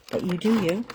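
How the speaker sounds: ordinary delivery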